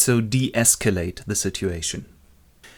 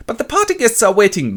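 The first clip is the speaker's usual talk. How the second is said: high, louder pleading; desperate plea; 12 semitones higher, 6 dB louder than usual. Acted. Bernard begs John to meet his guests, desperate plea. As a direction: plead on behalf of waiting guests. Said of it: "Bernard rather desperately knocks on his door".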